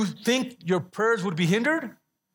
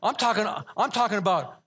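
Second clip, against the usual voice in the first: ordinary talk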